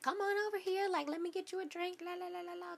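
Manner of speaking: high, soft singsong imitation; mocking catcaller. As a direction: mimic a pestering flirt, trailing into la-la babble. Mimics the man's repeated catcalls.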